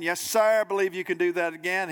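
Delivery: acted eager yes, tumbling out fast; eager spectator. Spectator tells the tightrope walker he believes, eagerly.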